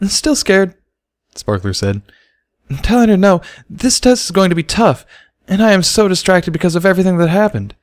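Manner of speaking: nervous fretting filly; still scared. Acted. Sparkler still panicking.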